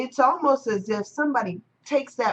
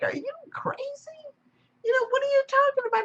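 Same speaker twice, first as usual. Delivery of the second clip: incredulous wave; faster than usual. Acted. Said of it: "he's looking at this wave like".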